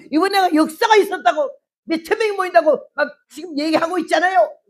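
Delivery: high, loud and insistent, pressing her point; wife demands her husband listen; excited shout. Retold or imitated.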